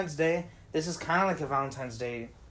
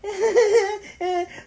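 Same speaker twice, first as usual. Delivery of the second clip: sudden high-pitched laugh; 5 dB louder than usual. Real, not acted. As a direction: burst into a squeaky laugh. Laugh mid story.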